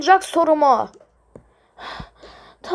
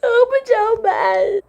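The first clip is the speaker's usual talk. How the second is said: high, tearful despair; crying; 7 semitones higher than usual. Acted. A lost child crying alone, tearful. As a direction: whimper out the hopelessness.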